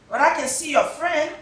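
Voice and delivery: quick, high grandma voice; lively grandma. Acted. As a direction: tease the child gently. Grandma speaking.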